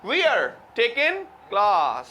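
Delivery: slow British English, "We are" merged into "wear", "taking" without the g; British accent. Shown as a demonstration. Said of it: "वो कहते हैं". (British लहजे में, "We are" को "वीयर" बोलते हुए)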